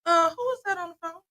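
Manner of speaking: mock nosy friends, pitched high. Friends overhearing mom's phone call, high nosy mimicry. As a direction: imitate a prying friend in a high voice. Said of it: "They be like".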